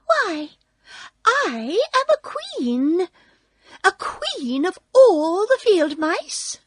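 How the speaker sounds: high, slow, regal squeak; indignant mouse queen. A narrator's reading. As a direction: proclaim royal rank in an affronted squeaky voice. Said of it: "cried the little animal indignantly".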